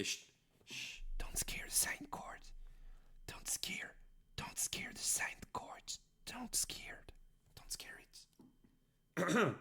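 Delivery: hushed whisper to a card. Pretend. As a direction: whisper it, don't startle it. Hushing so card stays calm.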